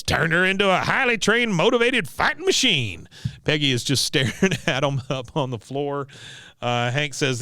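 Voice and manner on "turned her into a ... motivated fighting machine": put-on high-pitched proud old veteran; Cotton. Veteran answering his horrified son, imitated high proud boast. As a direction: announce it like a boastful drill instructor. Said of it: "He goes".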